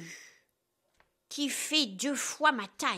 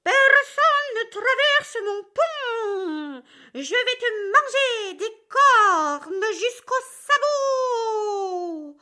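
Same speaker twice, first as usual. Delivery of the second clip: slow, loud, much higher troll roar; furious troll; 10 semitones higher, 5 dB louder, slower than usual. Acted. Furious troll threatens the middle goat, slow and roaring. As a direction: roar the threat to eat him whole. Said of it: "Le troll, furieux, bondit".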